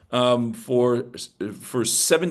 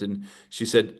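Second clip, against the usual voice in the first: normal talking voice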